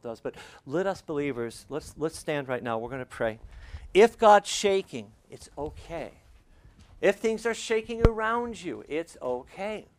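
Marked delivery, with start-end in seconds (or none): 3.9-9.9 higher and louder, preaching hard; fervent sermon peak; full-force preaching. Acted. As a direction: lift the room with a big pulpit voice.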